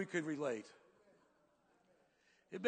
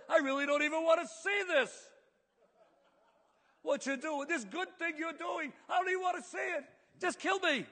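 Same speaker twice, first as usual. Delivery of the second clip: exasperated shout; 8 semitones higher than usual. Acted. Jonah shouting at God that he'd rather die, exasperated. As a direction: throw up your hands and yell at God.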